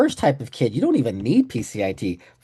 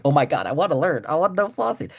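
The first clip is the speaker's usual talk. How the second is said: put-on eager kid excitement; 5 semitones higher than usual. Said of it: "But I'm like".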